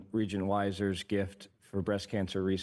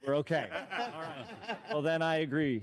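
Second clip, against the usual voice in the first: laughing; 6 semitones higher than usual. Real, not acted. Laughing after the joke lands.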